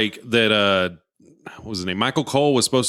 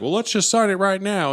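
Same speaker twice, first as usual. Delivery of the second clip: higher, lightly voiced mocking wrestler; mock taunt; 8 semitones higher than usual. JBL taunting Michael Cole in the ring, light mock taunt. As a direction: toss the name off with a smirk. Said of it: "And he's like".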